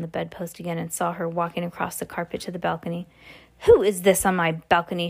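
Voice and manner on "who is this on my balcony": ordinary delivery, nothing marked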